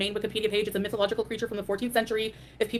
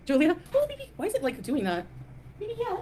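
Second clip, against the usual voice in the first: much higher cooing voice for the pet; 9 semitones higher than usual. Real, not acted. Cooing at her pet Julia.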